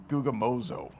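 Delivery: "gugamozo" said low like a grumble, stressed on "mo"; grumbling onomatopoeia. Shown as a demonstration. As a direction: sound out "gugamozo" as a Greek grumbling word. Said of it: "grumbling and complaining in the Greek are onomatopoeias".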